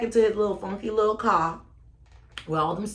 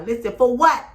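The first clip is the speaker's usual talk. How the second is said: angry demand; 6 semitones higher, 8 dB louder than usual. Retold or imitated. Retelling angry confrontation.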